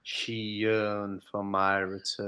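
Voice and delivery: slow, low, softly drawn-out line; mock dramatic quote. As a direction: intone it slowly, deep and hammy. Imitates a film's dramatic line.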